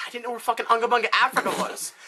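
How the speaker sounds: put-on boy blurting his confession. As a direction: spit it out in a rush. Billy blurts the answer.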